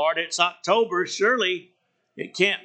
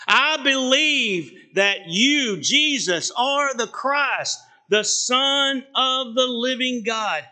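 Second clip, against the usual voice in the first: slow, higher, drawn-out declaration; confession of faith; 4 semitones higher than usual. Acted. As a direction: proclaim it slowly, stretching out the key words. Quoting Peter's confession of faith.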